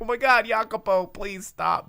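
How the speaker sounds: a hammed-up begging to stop; mock exasperation. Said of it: "And I'm like".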